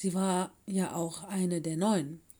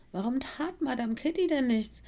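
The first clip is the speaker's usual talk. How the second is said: ordinary delivery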